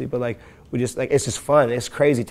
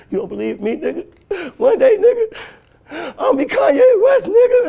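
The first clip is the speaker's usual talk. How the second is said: high crying yell, much higher and louder; crying scream; 9 dB louder than usual. Retold or imitated. Boy screaming at his dad through tears. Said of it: "And I was crying and shit, screaming".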